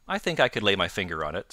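usual speaking voice

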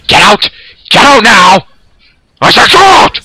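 rising, high, loud yell; furious shout. Real, not acted. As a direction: throw him out, shouting. Yelling helicopter pilot out.

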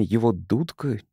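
plain reading voice

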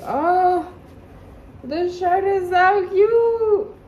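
high, loud, slow squeal; squealing with delight. Real, not acted. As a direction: melt over it, overjoyed. Gushing over shirt.

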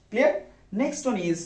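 normal talking voice

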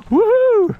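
loud high whoop; excited whoop. Real, not acted. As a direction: whoop with joy. Cheering on the ride.

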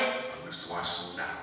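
low, soft doctor voice with no inflection; deadpan, unhurried medical calm; calm, cool doctor. Retold or imitated. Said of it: "he was just calm and cool".

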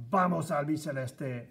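loud soccer chant cheer; excited cheer. Real, not acted. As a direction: shout it like a fan in the stadium. Cheering for Argentina.

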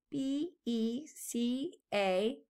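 slow, letter by letter; spelling out. Shown as a demonstration. Teacher spells the word for children. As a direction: spell each letter out slowly. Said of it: "remember because".

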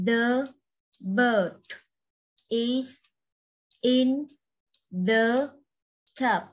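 each word of "The bird is in the tub" said separately with pauses between; đọc từng chữ một, ngắt quãng. Shown as a demonstration. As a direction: read "The bird is in the tub" one word at a time, like dictation. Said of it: "cô cho các em đọc lại từng chữ trong câu này".

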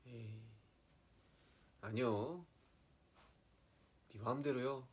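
lightly attempted Chungcheong lilt; Chungcheong dialect. Shown as a demonstration. Guessing at Chungcheong speech for fans, light Chungcheong accent. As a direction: try a hint of Chungcheong intonation. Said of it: "이게 약간 충청도 아니에요?".

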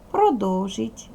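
ordinary talk

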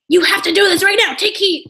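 imitated yelling. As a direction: act out a pushy caller yelling orders. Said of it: "they started yelling at me".

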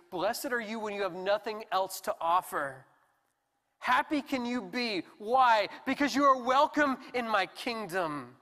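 Jesus welcoming the needy, loud and joyful; joyful proclamation. Acted. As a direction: throw open the doors with joy.

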